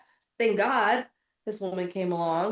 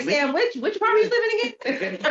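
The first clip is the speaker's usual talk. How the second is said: calling out; 13 semitones higher, 6 dB louder than usual. Retold or imitated. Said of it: "I'm like".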